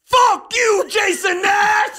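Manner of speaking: high, full-volume imitated scream; enraged mock holler; screaming stranger. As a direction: bellow it slowly, stretching the name. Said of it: "The guy just goes".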